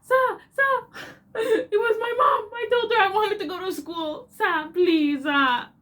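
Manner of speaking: high, loud mock wailing plea in Kenyan accent; hammed-up bawling, pleading at the top of the voice; screaming and crying. Child begging vice principal to spare her, hammed-up crying. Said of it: "The screaming and crying I did".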